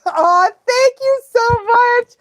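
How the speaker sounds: high, drawn-out, gushing thanks; touched gratitude. Real, not acted. Streamer thanking supportive fans, touched and gushing. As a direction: gush it slowly, really moved. Touched by fans' kind message.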